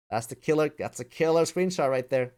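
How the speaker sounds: normal talking voice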